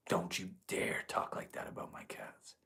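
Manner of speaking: hushed, teasing warning about the cats; voiceless playful threat, no pitch; mock whisper threat. Playful mock threat over cat.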